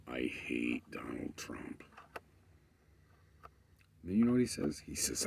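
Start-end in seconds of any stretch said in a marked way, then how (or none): 0.1-1.8 low slow whisper through gritted teeth; hissed whisper. Retold or imitated. Retelling a ranting guy, hissed through teeth. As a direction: hiss it through clenched teeth. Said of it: "grinding his teeth, kinda".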